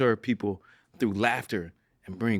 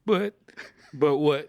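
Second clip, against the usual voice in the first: high, louder, cracking with laughter; helpless laughter; 6 semitones higher, 4 dB louder, slower than usual. Real, not acted. Host egging on guest's prayer story, helpless with laughter. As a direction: squeeze the question out through laughter.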